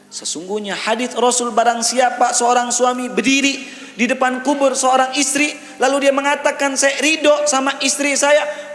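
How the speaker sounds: high-pitched sermon delivery; impassioned preaching. Real, not acted. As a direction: preach it high and insistent to the congregation. Preaching a hadith at full force.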